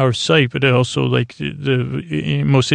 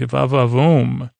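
mock ogling; slower than usual. Geordi's salacious comment to Wesley.

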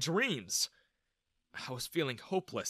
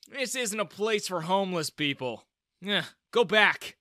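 lightly voiced contemptuous guard, higher and louder at the end; sneering; 5 semitones higher, 5 dB louder than usual. A narrator's reading. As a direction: sneer and send them away. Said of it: "he sneered".

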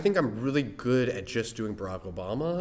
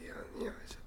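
whispering; 15 dB quieter, much faster than usual. Real, not acted. Hushed aside to himself.

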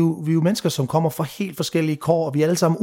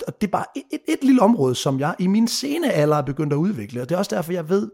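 ordinary delivery

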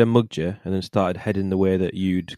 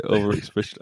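ordinary talk

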